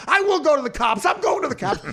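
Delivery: high, shouting woman threatening; shrill woman. Retold or imitated. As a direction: yell it like a hysterical wife. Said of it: "Sharon Stone keeps going".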